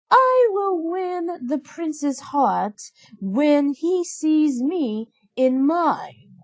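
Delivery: a high, slow, dreamy stepsister voice; lightly voiced spoiled stepsister, drawn out; Anastasia, high and slow. A narrator's reading. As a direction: coo it like a vain girl in love with herself. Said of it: "cried Anastasia".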